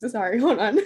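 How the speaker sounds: laughing it off while choking up; embarrassed giggle fighting back emotion; teary laugh. Real, not acted. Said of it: "I don't wanna get emotional".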